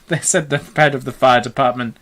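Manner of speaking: ordinary delivery, nothing marked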